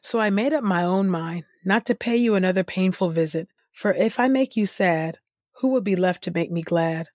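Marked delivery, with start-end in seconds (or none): none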